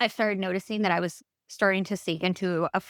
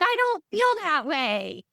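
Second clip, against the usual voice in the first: pitched-up sulky inner voice; fed-up inner voice; 9 semitones higher than usual. Retold or imitated. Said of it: "and I'm like".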